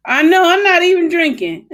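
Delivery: high, loud laughing denial; loud laughing protest. Real, not acted. Laughs while denying.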